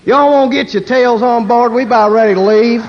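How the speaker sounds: a Texas PA announcer hollering; Texan announcer. Retold or imitated. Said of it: "Texas International".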